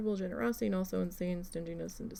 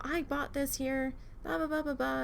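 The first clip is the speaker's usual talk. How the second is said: higher, lightly mocking whine of the customer; mock belligerent customer; 5 semitones higher, 3 dB quieter than usual. Clerk mimicking the angry customer, lightly mocking whine. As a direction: brush off her rant with mock blah blah. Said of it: "started getting belligerent".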